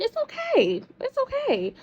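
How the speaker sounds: high, lilting it's okay; sing-song reassurance. Real, not acted. Telling viewers it's fine, high sing-song.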